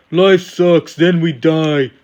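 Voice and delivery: lightly voiced emo heel; Bayley. Host quoting heel Bayley on Miz TV, as mock emo. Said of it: "Heel Bayley sitting on Miz T V saying".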